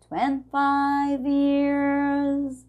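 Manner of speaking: "Twenty-five years" with the T dropped, very slow and sung; sung demo, no T in twenty; linked twenty-five. Shown as a demonstration. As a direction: glide "twenfaiv years" slowly. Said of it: "A gente não vai falar twenty-five".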